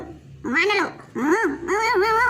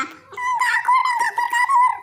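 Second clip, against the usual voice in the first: very high tiny mouse voice, cheeky; squeaky mouse taunt. Acted. Escaping mouse taunting the cat in a tiny squeaky voice.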